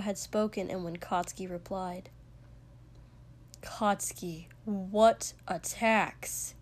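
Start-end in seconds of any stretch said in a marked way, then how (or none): 3.6-6.5 slow, insistent question; measured demand for an answer; deliberate repeat. A narrator's reading. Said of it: "Shoto repeated".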